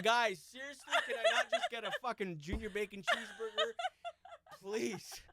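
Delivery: a man at his wits' end addressing "guys"; exasperated customer. Retold or imitated. Man at his wits' end yelling to the whole restaurant, exasperated. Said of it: "you're just like".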